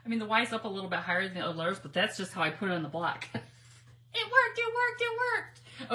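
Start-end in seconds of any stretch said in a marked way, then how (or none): 4.2-5.9 high, loud joyful cry; high excited squeal of joy. Real, not acted. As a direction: squeal with delight. Celebrating success.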